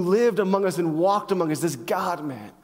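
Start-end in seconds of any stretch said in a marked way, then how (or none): none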